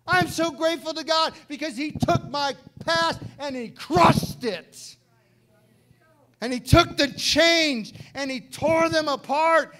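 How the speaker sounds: high, loud, fervent preaching cry; full-force preaching. Acted. Preacher crying out to the church about his own redemption, loud and high. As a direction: preach it with everything you have.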